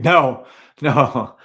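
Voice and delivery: real laugh through a no. Real, not acted. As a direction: brush it off with a laugh. Laughs off viewer question.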